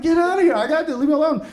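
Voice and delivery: loud, high-pitched frantic snapping; flipping out. Acted. Parent cleaning scalded toddler to waitress, flipping out. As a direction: drive them off in a panicked outburst. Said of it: "I'm flipping out".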